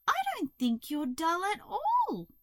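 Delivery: high caterpillar voice; reassuring Hazel. A narrator's reading. Hazel cheering up the crow, reassuring.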